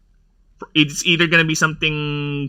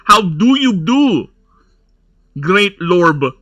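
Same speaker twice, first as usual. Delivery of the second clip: put-on Burb accent; 4 semitones higher, 3 dB louder, slower than usual. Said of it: "That's our accent".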